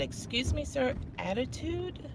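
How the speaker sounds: soft, slow puzzled query; retold hushed disbelief, rising at the end; quiet questioning. Guest questioning the manager's accusation, quietly. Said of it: "I was talking quieter than this".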